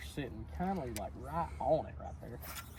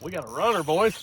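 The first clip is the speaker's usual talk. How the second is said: excited; 4 semitones higher, 9 dB louder than usual. Real, not acted. Fish on, calling out to crew.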